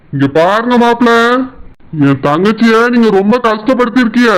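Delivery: a lightly voiced stern elder brother-in-law; a hint of a stern older brother. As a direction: scold him as the wife's protective brother. Brother-in-law scolds the husband.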